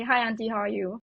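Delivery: ordinary delivery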